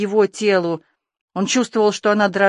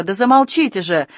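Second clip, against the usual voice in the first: plain reading voice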